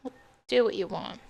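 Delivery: mother repeats it quietly, close to sobbing; broken surrender. Acted. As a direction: let the words collapse into defeat. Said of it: "Your mom sobbed uncontrollably".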